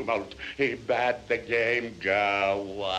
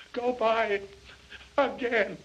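plain reading voice

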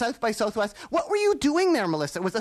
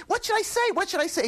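excited; 9 semitones higher, faster than usual. Retold or imitated. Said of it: "I said".

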